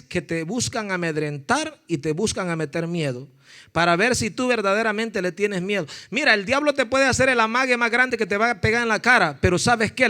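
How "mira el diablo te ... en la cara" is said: normal talking voice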